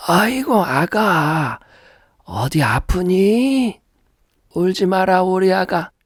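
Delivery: put-on high old granny voice coaxing a crying baby; soothing grandmother. Grandmother soothing her crying grandchild, high coaxing voice. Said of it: "울고 있는 아기를 달래는 할머니의 소리가".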